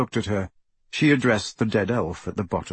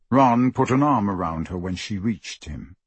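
ordinary delivery

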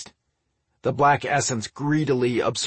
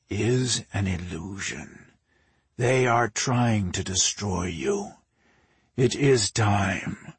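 slow, drawn-out sinister command; measured, menacing creature voice; commanding inner voice; 3 semitones lower, slower than usual. A narrator's reading. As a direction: command slowly, like a voice inside his head. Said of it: "the voice commanded".

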